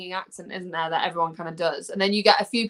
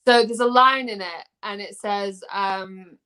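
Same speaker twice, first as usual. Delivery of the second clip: ordinary delivery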